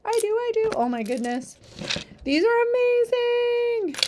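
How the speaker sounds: put-on high, sing-song baby talk; reading to a toddler. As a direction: coo at them like a toddler. Cooing at toys for kids.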